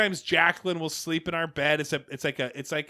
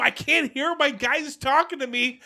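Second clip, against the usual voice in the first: mock gamer yell; 10 semitones higher, 5 dB louder than usual. Imitating himself gaming, yelling at wife.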